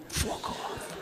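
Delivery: breathy whispered fuck off; whispered dismissal. Retold or imitated. Brother dismissing his sibling in a whisper. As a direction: hiss it under your breath.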